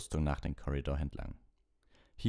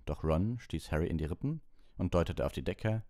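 usual speaking voice

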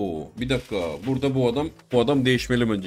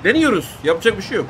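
higher and louder, pumped up; excited shout; 7 semitones higher, 5 dB louder than usual. Real, not acted. Gamer hyping himself up as he shoots, excited shout. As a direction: call it out like you're going for it.

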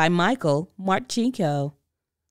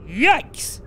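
startled yelp; 6 semitones higher, 5 dB louder than usual. Acted. Father startled.

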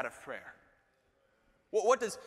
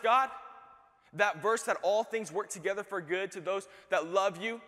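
ordinary talk, nothing marked

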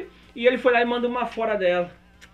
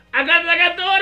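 high, loud excited cry; excited anime fan; 8 semitones higher, 6 dB louder than usual. Real, not acted. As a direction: squeal at the screen. Reação animada ao anime. (fã de anime empolgado)

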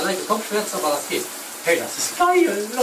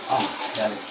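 sinking into hot bath; 10 semitones lower, 4 dB quieter, faster than usual. Real, not acted. Enjoying warm water on himself.

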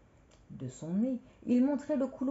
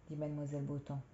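ordinary delivery

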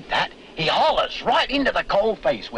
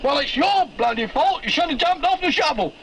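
higher, aggrieved greenhorn voice; greenhorn's retort; 11 semitones higher than usual. Retold or imitated. Greenhorn throwing down his tool, lightly voiced and aggrieved. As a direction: blame him, a hint of a put-upon novice. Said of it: "and he said".

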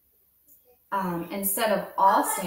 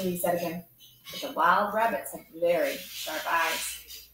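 ordinary delivery, nothing marked

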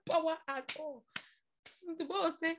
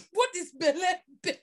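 usual speaking voice

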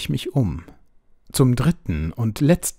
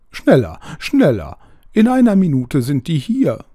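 high, loud, demanding bird voice; a panicky owl ordering its rescuer around; bossy owl; 4 semitones higher, 4 dB louder than usual. A narrator's reading. Wet owl bossing the narrator to carry it to a tree, high and urgent.

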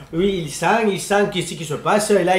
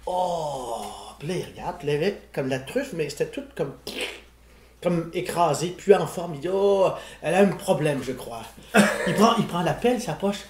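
much softer, lower, a hushed storyteller's voice; 4 semitones lower, 9 dB quieter than usual. Retold or imitated. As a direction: drop to a near whisper as if not to scare the fly. Hushed retelling of truffle hunt. (voix feutrée, presque chuchotée)

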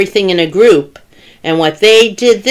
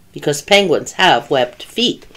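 ordinary delivery, nothing marked

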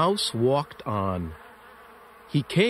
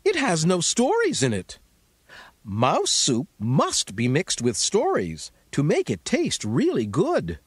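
much higher, small mouse voice; squeaky mouse voice; 7 semitones higher than usual. Acted. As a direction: voice the little mouse, high and bright. Mouse speaks in story.

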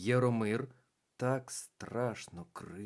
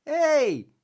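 much higher, louder merry hey; cheerful shout; 13 dB louder than usual. A narrator's reading. Дівчинка весело гукає дітей, високий вигук. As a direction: call out gaily. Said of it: "вигукнула вона весело".

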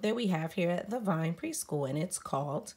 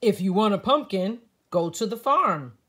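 louder, helpful squirrel voice; Max the squirrel; 3 semitones higher, 9 dB louder than usual. A narrator's reading. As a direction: point the way to the farm. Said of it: "says Max".

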